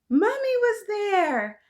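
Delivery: very high, loud squeal of joy; overjoyed child's shriek; excited squeal. A narrator's reading. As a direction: squeal it out in delight. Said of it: "I squealed with excitement".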